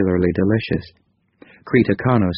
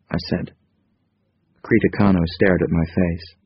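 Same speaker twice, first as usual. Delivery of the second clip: plain reading voice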